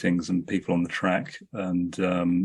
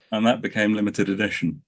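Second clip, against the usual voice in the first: ordinary talk, nothing marked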